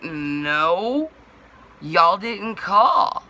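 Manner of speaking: loud, very drawn-out, rising objection; retold protest. Customer answering driver who honked outside, drawn-out protest. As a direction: stretch it out in mock disbelief.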